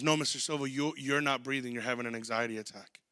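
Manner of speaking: soft, low, patient explaining; patient doctor. Retold or imitated. Doctor explaining an anxiety attack to the patient, softly patient. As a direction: calmly set the patient straight. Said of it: "He goes".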